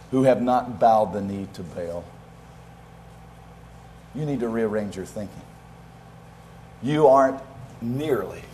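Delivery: God answering Elijah softly; quiet voice of God. Acted. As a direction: let it fall almost to a hush.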